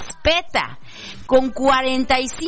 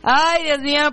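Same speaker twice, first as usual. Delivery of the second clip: laughing; 6 semitones higher than usual. Real, not acted. Laughing at own joke.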